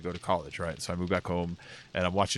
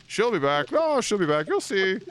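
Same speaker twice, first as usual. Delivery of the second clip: higher mock scoff; imitating scoffing dad; 10 semitones higher, 4 dB louder than usual. Dad insisting his leaving wife will return, scoffing. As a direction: scoff like a man in denial.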